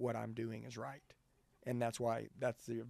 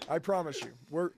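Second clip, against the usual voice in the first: ordinary talk, nothing marked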